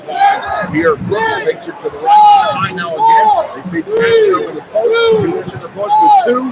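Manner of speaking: high, loud, rising play-by-play yell; radio announcer building with the play, voice way up; excited call. Real, not acted. Radio announcer calling the play to listeners, high excited yell.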